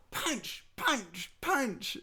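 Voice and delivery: imitating a toddler swinging fists; high chopped punch, punch, punch; toddler fighting. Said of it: "and so you go".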